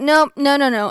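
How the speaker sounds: loud insistent no; loud refusal. Retold or imitated. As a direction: refuse loudly, over and over. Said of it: "she was like".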